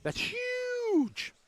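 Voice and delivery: very high, drawn-out "huge"; amazed exclamation. Real, not acted. Announcer marvelling at the size of the valley, amazed. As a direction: stretch it out high in wonder.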